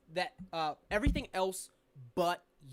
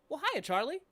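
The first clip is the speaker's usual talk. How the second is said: a higher, louder greeting from a poster; lightly voiced sanitation worker; 8 semitones higher, 6 dB louder than usual. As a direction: greet her as a figure come to life. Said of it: "turns to you and just goes".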